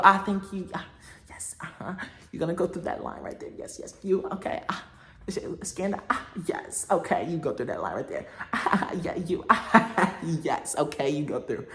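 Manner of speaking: hushed muttering airport agent; soft, half-voiced impression of a busy airport worker; imitating the lady. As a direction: mimic a lady quietly waving people through. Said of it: "She, she was like this".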